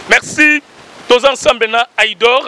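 a sudden high, loud burst of thanks; excited thanks. Real, not acted. Host shouting thanks to supporters, high and loud. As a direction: throw out the thanks with a jump in pitch and volume.

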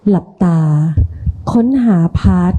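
slow, soft guided relaxation voice; soft spa whisper. Shown as a demonstration. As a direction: lead the group calmly through breathing. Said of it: "ดิฉันเข้าโหมดผู้นำกระบวนการได้อย่างรวดเร็ว".

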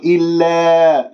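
"إلا" with the final aa bent towards eh; chanted "إلا" with a fronted, thin alif; tilted alif. Shown as a demonstration. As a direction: demonstrate the error: long slanted vowel on "إلا". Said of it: "لا تمل صوت الألف".

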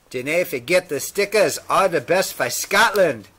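put-on loud, high-pitched Scottish accent. Reading out a Scottish fan's letter sign-off in a Scottish accent. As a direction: belt it out in a lilting Scottish accent.

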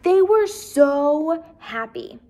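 ordinary delivery, nothing marked

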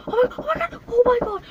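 high, loud frightened squeal; panicked shriek. Real, not acted. Panicking in the car, shrieking at the clown. Panic after the window breaks.

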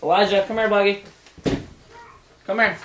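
loud, high call across the room. Real, not acted. Calling the child over.

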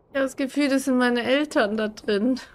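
usual speaking voice

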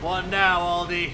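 jeering call at the enemy. Acted. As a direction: throw the taunt back harder. Repeats taunt louder, higher.

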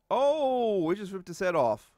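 loud, high shocked yell; excited outburst. Real, not acted. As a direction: blurt it out in delighted shock. Shocked at game gore.

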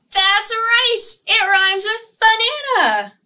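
loud, high, sung-out banana; excited reveal. Acted. Librarian revealing her name rhymes, excited sing-song. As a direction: announce it with a burst of delight.

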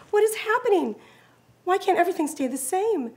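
put-on young fawn in distress; alarmed fawn. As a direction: cry out bewildered at the storm. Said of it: "fawn alarmed by the snow".

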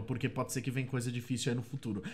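ordinary delivery

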